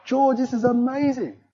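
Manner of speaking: high, impressed exclamation over the car; amazed. Retold or imitated. To his friend George, admiring the new Porsche, high and impressed.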